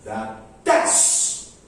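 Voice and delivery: low unstressed "The", then a punched "does"; two separate beats, "does" stressed. Shown as a demonstration. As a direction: drop "The" and hit "does". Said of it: "does stress".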